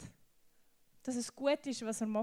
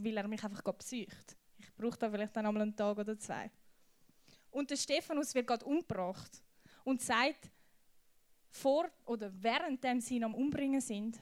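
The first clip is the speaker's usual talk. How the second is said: ordinary delivery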